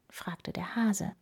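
plain reading voice

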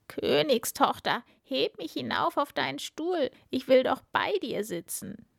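high, wheedling frog voice; demanding frog. A narrator's reading. Frog asking the princess for her chair, demanding frog.

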